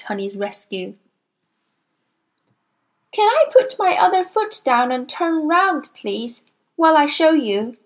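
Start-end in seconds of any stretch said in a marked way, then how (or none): 3.1-7.8 put-on higher, polite boyish asking; a boy on one leg asking the headmistress; eager boy. A narrator's reading. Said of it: "the brave Nigel".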